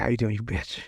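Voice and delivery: low, soft muttered jab; retold aside, kept under the breath; under his breath. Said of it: "under his breath".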